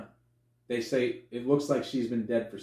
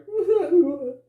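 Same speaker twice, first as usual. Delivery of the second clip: high, loud mock wailing; imitated crying; 8 dB louder than usual. Crying heard through the door, mock wail. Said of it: "Somebody crying in the comfort".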